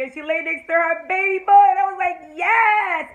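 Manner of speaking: high, loud squealing delight; thrilled, swooning cheer; gushing fan excitement. Real, not acted. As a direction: build to a screamed YES. Fan gushes over couple lying together.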